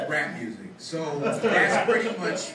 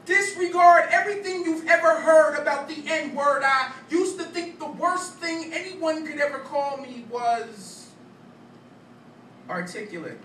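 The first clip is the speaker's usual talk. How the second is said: higher, louder, rhythmic slam-poem delivery; spoken-word poetry; 13 semitones higher, 5 dB louder than usual. Acted. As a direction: perform it as a punchy stage poem.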